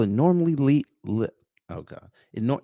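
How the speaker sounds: ordinary delivery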